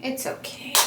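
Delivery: breathed under the breath, toneless; self-soothing whisper. Real, not acted. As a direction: whisper it to calm yourself down. Consoling herself after mascara smudge.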